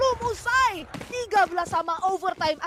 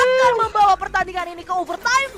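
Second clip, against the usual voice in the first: excited caster; 7 semitones higher, 7 dB louder than usual. Real, not acted. Caster hype at overtime clutch.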